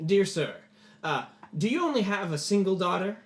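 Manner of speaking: lightly voiced noble addressing a father; lordly enquiry. Lord questions the father.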